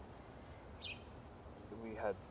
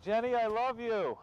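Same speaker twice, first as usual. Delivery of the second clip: put-on pleading boyfriend; 8 semitones higher than usual. Boyfriend acting as he calls leaving girlfriend back, pleading. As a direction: call after her higher, hammed-up begging.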